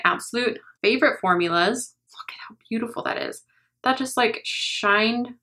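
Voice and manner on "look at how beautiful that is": awed whisper. Real, not acted. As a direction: breathe it out in awe. Admiring shade up close.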